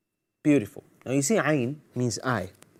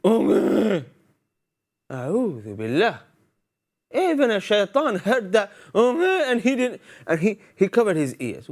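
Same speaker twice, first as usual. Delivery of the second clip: mock complaining; 8 semitones higher than usual. Kid grumbling at a parent's request, hammed-up whiny protest. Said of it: "they would say".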